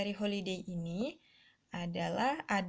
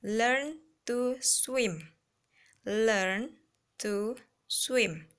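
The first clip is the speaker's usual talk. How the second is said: slow and louder for the whole class; teacher drilling a phrase, slow and loud; slow, loud model reading; 4 dB louder, much slower than usual. Shown as a demonstration. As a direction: project it slowly so every child hears. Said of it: "And then next".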